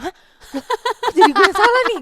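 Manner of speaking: high, loud shocked protest; loud indignant child; indignant surprise. Retold or imitated. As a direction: protest like a wronged kid.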